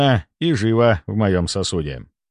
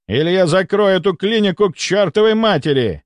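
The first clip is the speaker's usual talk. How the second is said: high, indignant ultimatum; angry threat; 9 semitones higher, 4 dB louder than usual. A narrator's reading. The count threatens to shut the clinic down, angry. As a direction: threaten them in a raised, commanding pitch.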